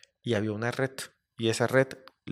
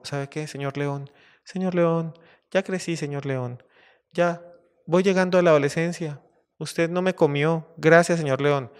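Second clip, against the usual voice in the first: higher-pitched acted little mouse; 5 semitones higher than usual. Grown mouse thanks the lion who spared him, higher voice. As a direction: speak up as a small, earnest mouse.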